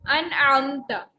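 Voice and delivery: अनअमता drawn out slowly, ain clear in the throat; slow Quranic example. Shown as a demonstration. Teacher gives the word as an example, slowly and clearly. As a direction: stretch अनअमता, voicing the ain. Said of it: "अब आइन देखें".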